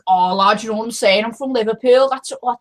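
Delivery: broad Scouse, lifting at phrase ends; hammed-up Scouse accent. Shown as a demonstration. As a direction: demonstrate a thick Liverpool accent. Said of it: "a Scouse accent, like".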